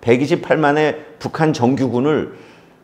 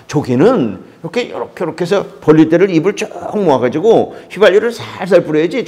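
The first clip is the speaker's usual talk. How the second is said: lively Pyongyang dialect, loud and fast; North Korean accent; 3 semitones higher, 4 dB louder, faster than usual. Retold or imitated. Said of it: "북한 말투로 좀 얘기했습니다".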